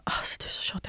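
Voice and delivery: soft whisper, barely voiced; imitated whisper. Boyfriend whispering a jab in the kitchen, soft whisper. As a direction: hiss it quietly like a secret. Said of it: "han bara".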